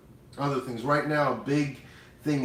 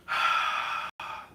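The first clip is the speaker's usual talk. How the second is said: long, voiceless breath taking in a smell; demonstrated sniff. Shown as a demonstration. Host showing listeners cooking smells, long audible breath.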